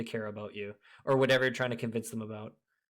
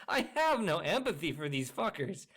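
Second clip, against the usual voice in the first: ordinary talk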